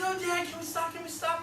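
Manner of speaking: put-on high-pitched begging child voice; pleading kids. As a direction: plead like an excited kid to Dad. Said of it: "My kids".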